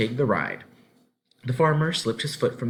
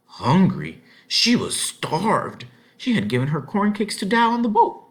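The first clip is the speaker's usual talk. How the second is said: emphatic; 11 semitones higher, 6 dB louder than usual. A narrator's reading. Narration of hunger.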